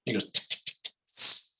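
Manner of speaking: tick-tick clicking then a hissing brake; whispered mechanical clicks and hiss; coaster clicks. Retold or imitated. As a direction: mimic the coaster's ratchet and air brake.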